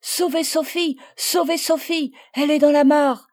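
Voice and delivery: high, loud, panicked cries; a child screaming for someone to save her friend; desperate shouting. Acted. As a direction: call for help at the top of your voice. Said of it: "criait Marguerite".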